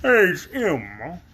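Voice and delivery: slow pompous big bug; pompous Wogglebug. Acted. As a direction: lecture like a vain insect.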